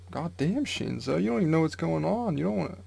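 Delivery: sing-song mock pity, voice leaping up; amused high exclaim. Real, not acted. Teasing the clueless Shinzo in a high sing-song voice.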